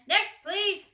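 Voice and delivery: loud, high call; loud dismissal. A narrator's reading. Grocer loudly calling the next customer.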